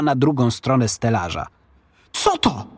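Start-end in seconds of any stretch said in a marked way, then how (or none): 2.1-2.7 high startled question; raised pitch, moderate volume; boy's exclamation. A narrator's reading. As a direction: exclaim it like an alarmed boy. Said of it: "wykrzyknął chłopiec".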